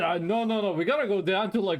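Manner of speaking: usual speaking voice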